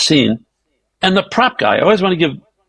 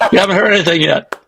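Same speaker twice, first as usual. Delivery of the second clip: usual speaking voice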